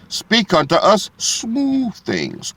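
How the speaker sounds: slow, high, mocking sweetness; mock sweet request. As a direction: drag it out like a spoiled crowd wanting comfort. Mocking the people quoted in scripture.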